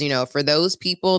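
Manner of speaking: ordinary talk, nothing marked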